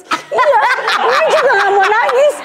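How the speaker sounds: high-pitched mock sobby voice; tearful child insisting. Daughter promises not to cry, mimicked high and weepy. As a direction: imitate a child fighting back fake tears. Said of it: "Tapi ambil pegang tisu".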